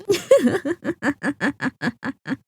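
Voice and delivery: long, rippling mocking laugh; villainous chuckle. Vampire mocking a protector of little friends, drawn-out laugh. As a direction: laugh at their hopes like a gloating villain.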